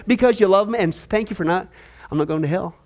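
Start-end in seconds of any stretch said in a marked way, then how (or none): none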